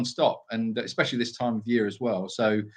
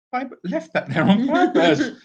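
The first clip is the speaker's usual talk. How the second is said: laughing; 13 semitones higher, 5 dB louder than usual. Real, not acted. Laughing at own crumb.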